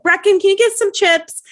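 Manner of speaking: very high, loud mom imitation; mock calling out. Imitating mom asking a child for chips mid-sale, calling out. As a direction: call across to a child, imitating.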